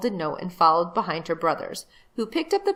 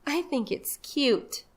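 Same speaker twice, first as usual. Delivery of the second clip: put-on softer, higher, slow little girl; shyly pleading for the baby; timid little girl; 7 semitones higher, 6 dB quieter, slower than usual. Jane voiced, timid.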